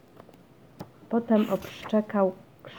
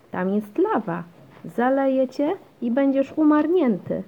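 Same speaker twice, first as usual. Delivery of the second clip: mildly dramatic, climbing higher and louder; child's warning cry; 7 semitones higher, 7 dB louder than usual. A narrator's reading. Basia warns Janek about pretend lava, crying out. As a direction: raise the alarm like a child at play. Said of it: "krzyknęła Basia".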